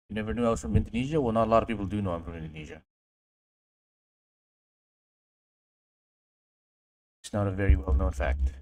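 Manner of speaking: loud, fast, playful mock outrage; mock indignant. Streamer teasing chat about being from Indonesia, loud and fast. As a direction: act scandalized that nobody knew, rushing it.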